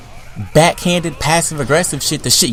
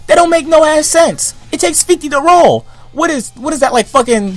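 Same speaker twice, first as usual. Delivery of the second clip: high, loud indignant complaint; outraged; 13 semitones higher, 5 dB louder than usual. Real, not acted. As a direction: protest the rip-off, pitched way up and loud. Outraged rant about game prices.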